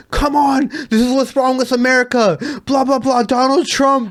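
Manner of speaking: high-pitched mock rant; imitated pissed-off. European DJ rants at the guys in the van, imitated anger. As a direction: imitate an exasperated man ranting at Americans. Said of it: "He was fucking pissed".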